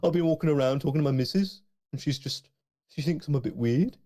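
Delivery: high Brummie lilt, every line rising like a question; Birmingham accent. Retold or imitated. Birmingham man describing his wife's view, in a Brummie accent. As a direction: put on a Brummie voice. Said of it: "Birmingham sounds a bit like this".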